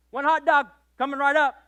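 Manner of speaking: bright, loud upbeat server's call; a touch of a cheerful waitress; cheery waitress. Retold or imitated. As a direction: sing it out as she walks away. Waitress cheerfully takes boy's order.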